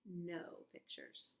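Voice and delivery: a conspiratorial hushed aside; teasing whisper. Shown as a demonstration. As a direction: whisper it slyly to the kids.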